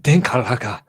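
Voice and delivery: loud, aggressive German accent. Shown as a demonstration. Host repeating the beer name for a friend, in an aggressive German accent. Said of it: "I said it in a German".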